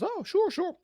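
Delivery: high, light imitation of the barman. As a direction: mimic the easygoing barman. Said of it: "And the guy goes".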